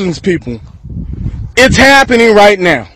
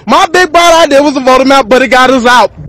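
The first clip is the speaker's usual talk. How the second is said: ordinary delivery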